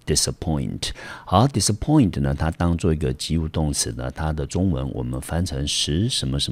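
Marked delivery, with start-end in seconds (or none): 0.1-0.9 disappoint with the weight on "point"; teacher model, stress falling on the last syllable; stress on the third syllable. Shown as a demonstration. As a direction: land firmly on "point" in disappoint. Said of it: "中音节落在第三个音节".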